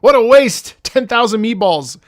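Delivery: high, loud cry of frustration; exasperated outburst. Real, not acted. Streamer upset at a viewer's wasted meatballs, exasperated. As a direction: lament it loudly, really annoyed. Exasperated at broken paid reward.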